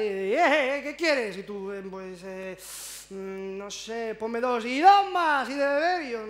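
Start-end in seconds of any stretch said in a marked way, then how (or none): 0.0-1.4 loud, higher bark of a rude waiter; surly bartender yelling over the bar. Retold or imitated. Gruff bar waiter barking at customer. 4.2-6.0 loud, slow shouted bar order; shouting order. Retold or imitated.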